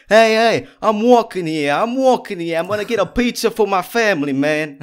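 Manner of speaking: put-on over-the-top Brooklyn accent shouted at traffic. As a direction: bellow it like a Brooklyn guy crossing the street. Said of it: "I can do Brooklyn".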